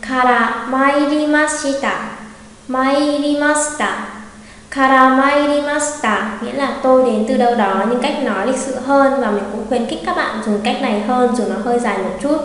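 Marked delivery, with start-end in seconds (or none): 0.1-6.3 slow, each syllable spelled out; slow careful reading. Shown as a demonstration. Teacher reading slowly for students to repeat. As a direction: read it slowly for learners to copy. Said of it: "Đọc lại này".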